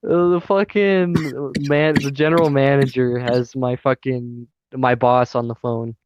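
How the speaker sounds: ordinary talk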